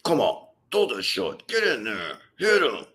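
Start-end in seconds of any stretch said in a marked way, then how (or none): none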